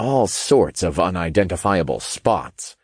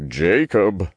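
slow, drawn-out cautioning name; warning. A narrator's reading. Raphael cautions Jacob against telling John, warning. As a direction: caution him in a character voice. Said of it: "Raphael warned".

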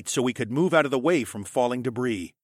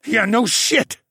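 loud, raised, bitter retort; angry growl; 11 semitones higher, 7 dB louder, slower than usual. A narrator's reading. Alex answering Jenner while kicking rubble, growling angrily. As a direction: growl it back, fuming. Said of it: "I growled".

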